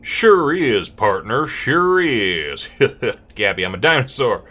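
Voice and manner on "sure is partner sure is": loud, hammed-up cowboy agreement; big Wild West drawl; cowboy character. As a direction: whoop it up like a cowpoke. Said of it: "Gabby doesn't talk like this".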